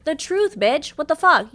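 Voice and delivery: shouted high and loud; furious retort. Real, not acted. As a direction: snap back at him at full volume.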